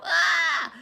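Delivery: loud high scream; children shrieking in chaos; kids scream. Retold or imitated.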